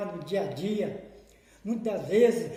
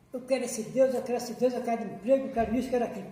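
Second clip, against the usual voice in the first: higher, insistent run of demands; mock demanding prayer; 4 semitones higher, 4 dB louder, faster than usual. Preacher mimics churchgoers begging God for jobs, mock insistent. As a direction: rattle off the wishes like a pushy petitioner.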